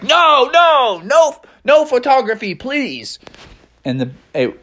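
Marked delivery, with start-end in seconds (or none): 0.0-3.2 high, wailing garbage man; panicked protest. Acted. Garbage man begs Techno Bear not to scan him, high panicky voice. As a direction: plead with swooping high cries. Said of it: "garbage man was like".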